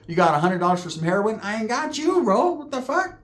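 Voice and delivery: incredulous, climbing into a high pitch; mock incredulous. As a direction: balk in a rising, high-pitched voice. Acted refusal of a bigger request.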